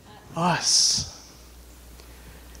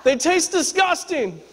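lightly voiced upset child, pitched higher; mock a disappointed kid's protest; complaining little girl; 8 semitones higher than usual. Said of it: "She goes".

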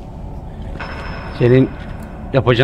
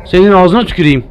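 startled curse; 4 semitones higher, 4 dB louder than usual. Real, not acted. Cursing after a jumpscare.

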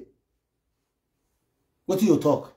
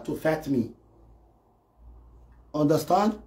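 normal talking voice

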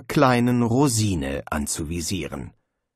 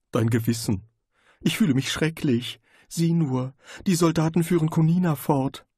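a lightly voiced nagging conscience; a hint of a guilty inner voice; 5 semitones higher than usual. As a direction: speak as the inner voice, reproachful. Conscience voice in his head.

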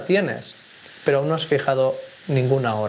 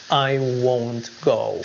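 "I won't go" said slowly, each word clear; slow model sentence; much slower than usual. Shown as a demonstration. Teacher modelling slow English sentence. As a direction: say it slowly for learners. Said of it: "No iré".

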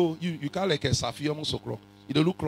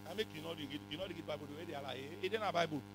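aside; 17 dB quieter, faster than usual. Real, not acted.

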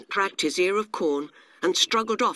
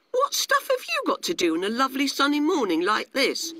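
high, swooping grasshopper voice; puzzled question from an idle grasshopper; high-pitched disbelief in character; carefree grasshopper, incredulous; 9 semitones higher, 3 dB louder than usual. Acted. Narrator plays carefree grasshopper.

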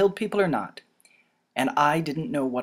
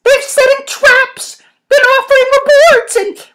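very high, loud, stretched-out pleading; high-pitched alarmed cry; urgent character warning; 17 dB louder than usual. Acted. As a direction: warn him urgently at the top of the range. Voices Bella warning Jake urgently.

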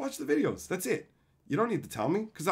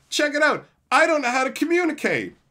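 high, loud boastful mock; mock bragging; 11 semitones higher, 7 dB louder than usual. Streamer mocking Twitter users bragging about blocks, high loud. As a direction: brag like a proud Twitter user. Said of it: "It's like".

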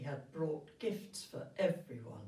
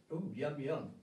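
mock yum; 3 semitones lower than usual. Narrator aside on sweets.

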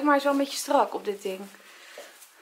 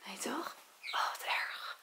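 breathless whispered dismay; whispering; 12 dB quieter, slower than usual. Real, not acted. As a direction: whisper it in shock. Shocked at her reflection.

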